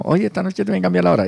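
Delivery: high, fast playful announcement; teasing announcer. Retold or imitated. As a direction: toss it off like a cheeky host. Host quoting his own announcement.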